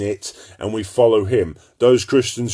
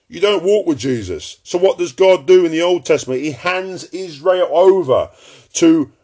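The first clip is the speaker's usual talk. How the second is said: higher, louder fiery sermon voice; preaching at full force; 9 semitones higher, 5 dB louder than usual. Acted. Preacher warning his listeners they no longer walk with Jesus, thundering. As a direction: thunder it from the pulpit.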